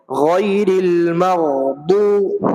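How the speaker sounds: slow, drawn-out tajweed recitation; Quran recitation. Shown as a demonstration. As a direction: recite it slowly and melodically. Recitation example after explanation. (آہستہ، کھینچ کر تلاوت)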